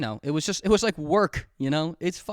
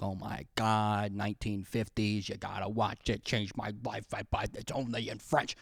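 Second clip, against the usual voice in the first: hushed reverent cinephile gushing, lower and softer; pretentious film buff friend; 7 semitones lower, 8 dB quieter than usual. Retold or imitated. Friend recommending a French film, in a hushed reverent gush. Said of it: "my friend is like".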